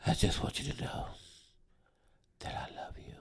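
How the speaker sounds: put-on breathy drunken whisper. Drunk friend whispering love to the comic. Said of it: "drunk friend quote, whispered".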